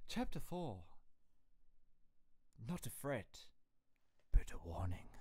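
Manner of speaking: put-on posh chapter announcement; mock posh accent. Shown as a demonstration. Streamer trying out the next chapter title, in mock posh accent. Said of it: "I can't do next chapter like".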